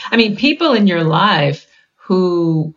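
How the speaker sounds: normal talking voice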